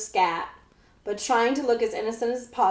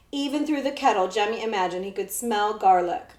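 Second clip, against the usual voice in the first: plain reading voice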